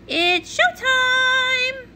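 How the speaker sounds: slow, high, drawn-out showtime call; a grand stage announcement. Acted. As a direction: announce it like a ringmaster.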